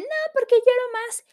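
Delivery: high whiny imitation of someone crying; mocking a crier who refuses a hug. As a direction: whine it like a sniffling person pushing you away. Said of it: "te empujan o te dicen".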